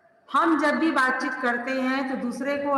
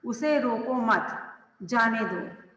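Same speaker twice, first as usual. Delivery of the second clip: slow "उसे रोको मत", pause, then "जाने दो"; "रोको मत" as one group, "जाने दो" set apart; comma after मत; slower than usual. Shown as a demonstration. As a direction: stop clearly after "मत" before "जाने दो". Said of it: "जाने के लिए कहा जा रहा है".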